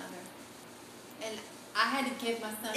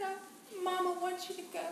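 tearful goodbye; 8 semitones higher, 5 dB quieter than usual. Retold or imitated. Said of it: "I said".

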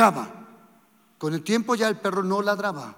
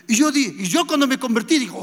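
ordinary talk

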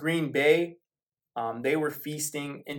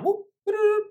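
high excited whooping cheer; 5 dB louder than usual. Acted. Host cheers the imagined breakout game, excited whoop. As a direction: whoop like a fan celebrating.

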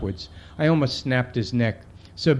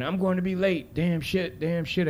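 lightly voiced fretting kid, higher pitch; kid talking to himself; 5 semitones higher than usual. Arrested kid worrying to himself, higher-pitched muttering imitation. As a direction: fret to yourself in a higher voice. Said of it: "starting to talk to himself now".

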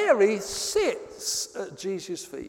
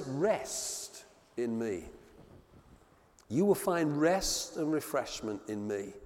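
tender invitation; 6 semitones lower, 5 dB quieter, slower than usual. Acted. Jesus' invitation quoted tenderly.

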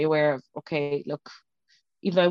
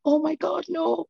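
high-pitched retold gasp of disbelief; shocked disbelief; 11 semitones higher, slower than usual. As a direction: re-enact the shock, pitch leaping up. Acting out reading the test.

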